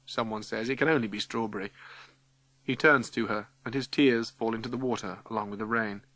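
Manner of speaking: ordinary delivery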